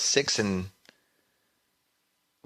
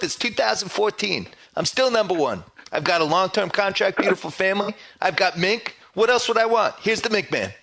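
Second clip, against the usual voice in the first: Mike Francesa impression; 5 semitones higher than usual. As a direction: rant like a blustering radio host. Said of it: "in the Dog Voice".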